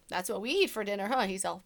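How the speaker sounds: plain reading voice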